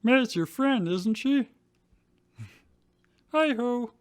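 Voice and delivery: high frog-puppet voice; Kermit voice. Acted. Drew prodding Ash about May, Kermit voice. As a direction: chirp it like Kermit. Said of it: "trying to be Kermit".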